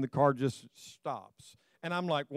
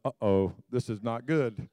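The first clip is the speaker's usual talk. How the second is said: a low, hushed uh-oh on the phone; worried uh-oh; 7 semitones lower than usual. Retold or imitated. Preacher retelling to son on phone, low worried. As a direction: sink into low dread as trouble looms. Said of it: "And I said, talking to my son".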